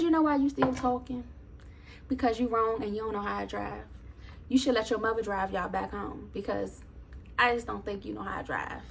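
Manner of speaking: passive aggressive. Retold or imitated. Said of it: "I'm being passive aggressive".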